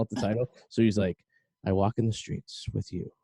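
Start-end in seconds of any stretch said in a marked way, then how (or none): 1.6-3.1 lightly voiced deep, soft late-night DJ; a hint of hushed romantic radio voice; [name]. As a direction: imitate a smooth bedtime radio host, softly. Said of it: "really, really deep voice".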